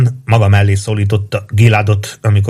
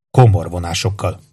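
usual speaking voice

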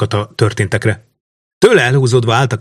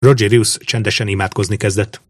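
plain reading voice

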